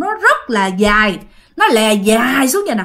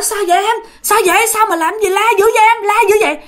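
worried neighbours; 9 semitones higher, faster than usual. Retold or imitated. Neighbours rush out, ask.

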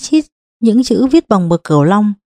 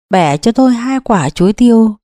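usual speaking voice

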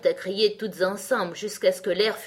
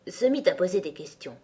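ordinary delivery

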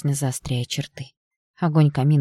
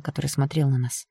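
plain reading voice

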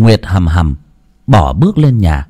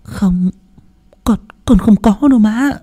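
high, stammering denial; 11 semitones higher than usual. A narrator's reading. Daughter-in-law stammers a denial to the mother-in-law. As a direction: stutter a meek no. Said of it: "Nguyệt lắp bắp".

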